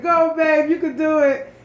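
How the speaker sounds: high, loud cheer. Retold or imitated. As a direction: shout it like from the crowd. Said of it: "He was like".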